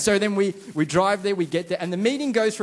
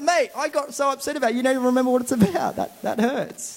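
higher, hammed-up wounded complaint; mock hurt; 7 semitones higher than usual. Pastor tells Pastor John on the phone he's hurt, mock wounded. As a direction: play the offended friend for laughs. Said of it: "I'm just like".

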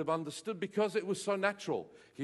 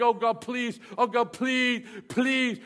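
mock begging; 6 semitones higher, 6 dB louder, slower than usual.

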